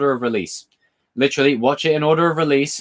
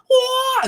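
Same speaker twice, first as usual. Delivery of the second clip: high, sharp karate cry; squeaky martial-arts "Wah!"; mock karate yell. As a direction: shout it like a crane kick. Imitating a karate kick cry.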